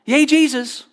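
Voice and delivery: high, bright-eyed cheer of a fickle fan; mock enthusiast cheer. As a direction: parody a short-lived believer cheering. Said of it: "translates this line as the short-term enthusiasts".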